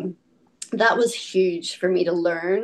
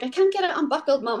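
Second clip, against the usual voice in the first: put-on high, fast kid whine; rushed childish plea; son whining; 8 semitones higher, faster than usual. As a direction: mimic a frantic little boy. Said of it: "he was like".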